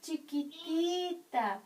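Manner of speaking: light imitation of a small, soft voice; soft little voice. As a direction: shrink the voice like the gentle teacher. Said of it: "voz suavecita, chiquitita".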